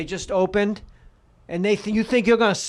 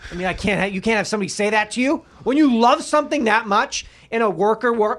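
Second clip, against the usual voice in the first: ordinary talk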